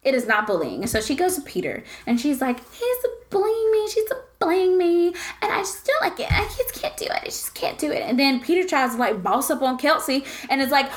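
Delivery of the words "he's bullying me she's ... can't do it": high, whimpering tearful imitation; hammed-up poor-me whine, fading at the end; mock victim. Friend imitating a contestant complaining to the bachelor, mock victim whine. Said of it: "plays the victim to Peter".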